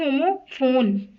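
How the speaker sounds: usual speaking voice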